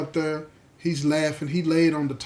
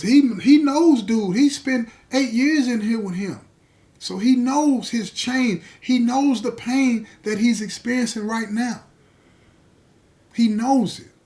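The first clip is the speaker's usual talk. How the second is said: much higher and louder, driving each line home; preaching at full force; 8 semitones higher, 5 dB louder than usual. Acted. Preacher to the congregation about the crying officer, full-force preaching. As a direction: build the congregation to the peak.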